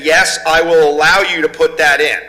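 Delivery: usual speaking voice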